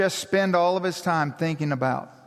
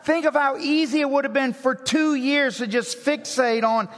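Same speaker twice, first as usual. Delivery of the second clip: raised, driving pulpit voice; impassioned preacher, higher and faster; preaching at full force; 8 semitones higher than usual. Acted. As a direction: build it up like a sermon climax. Preaching climax.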